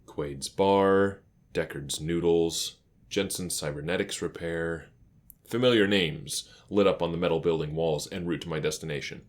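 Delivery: plain reading voice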